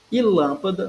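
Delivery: "lâmpada" with a high, loud LÂM; stress on LÂM. Shown as a demonstration. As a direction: hit the first syllable of "lâmpada". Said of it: "na antepenúltima sílaba".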